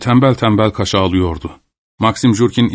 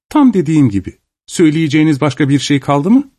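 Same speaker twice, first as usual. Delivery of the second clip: lightly voiced lady, higher and dismissive; a hint of an impatient mistress, pitched up; haughty lady; 5 semitones higher than usual. A narrator's reading. Lady dismissing a peasant's request, lightly voiced haughty.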